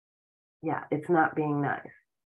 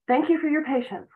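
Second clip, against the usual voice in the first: bright, quick thank you, pitched much higher; cheerful new script; 9 semitones higher, 4 dB louder, faster than usual. Shown as a demonstration. Coach demonstrating a script to replace apologies, upbeat. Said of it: "reprogram yourself with new scripts".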